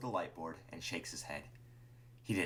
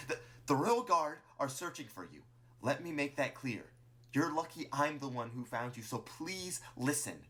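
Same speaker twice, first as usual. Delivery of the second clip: high, insistent warning with a stumbling start; urgent Martlet; 7 semitones higher than usual. Acted. Martlet warns Clover of the Royal Guard, urgent and pleading. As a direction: warn firmly, slowing into a plea.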